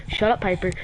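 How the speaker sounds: ordinary talk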